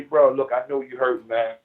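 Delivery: lightly voiced generous friend; sincere giving. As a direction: talk down low like a brother who means it. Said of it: "I walk up to you and I say".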